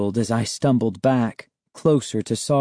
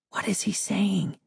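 soft, high whispered question; 9 semitones higher, 7 dB quieter than usual. Acted. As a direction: whisper it while hiding. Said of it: "Sari whispered".

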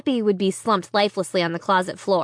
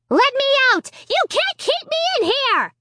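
raging dummy. A narrator's reading. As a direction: rage from behind the door. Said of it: "Slappy raged".

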